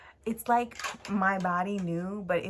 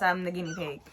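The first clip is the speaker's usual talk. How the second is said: normal talking voice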